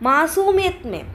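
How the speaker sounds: മാസൂമിയത് said high and loud; teacher answering her own question with emphasis; stress on മാസൂമിയത്. Shown as a demonstration. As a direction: lift മാസൂമിയത് clearly. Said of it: "എങ്ങനെയായിരുന്നു ചെയ്തതെന്ന് പറഞ്ഞത്?".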